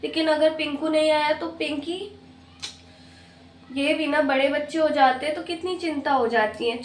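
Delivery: ordinary talk, nothing marked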